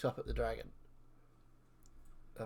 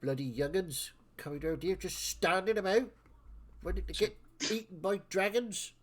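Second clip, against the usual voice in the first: raised, high-pitched chivvying, easing into a grumble; exasperated old-timer herding the party; grumbling bossy elder; 8 semitones higher, 10 dB louder than usual. Acted. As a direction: chivvy the youngsters along, then grumble. Elder chivvies the young ones.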